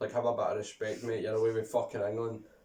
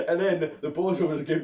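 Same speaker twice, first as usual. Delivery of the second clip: usual speaking voice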